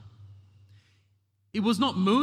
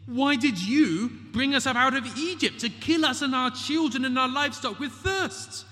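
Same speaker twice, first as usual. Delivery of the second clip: high, accusing crowd voice; angry complaint; 9 semitones higher than usual. Acted. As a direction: throw the blame at Moses, higher in pitch. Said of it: "They say".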